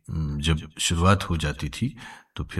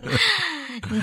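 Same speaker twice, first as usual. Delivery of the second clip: real chuckle at own joke; amused laugh. Real, not acted. (अपने मज़ाक पर हँसी)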